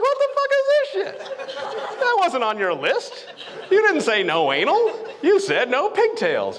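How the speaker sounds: mock indignant; incredulous protest.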